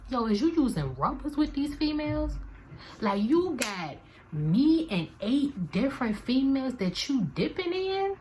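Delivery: high-pitched disbelief at his cheating; incredulous inner voice. Retold or imitated.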